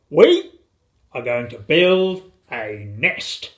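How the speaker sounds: slow, suspenseful announcement; father announcing. A narrator's reading. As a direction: declare the plan grandly.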